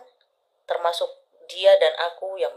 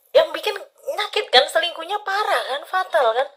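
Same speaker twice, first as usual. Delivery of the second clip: indignant; 11 semitones higher than usual. Real, not acted. Outraged about cheating.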